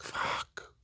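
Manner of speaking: barely audible whisper, all breath; whispered curse. Retold or imitated. Overthinker cursing to himself after what-ifs, whispered. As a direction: whisper it under your breath. Mutters own worried reaction.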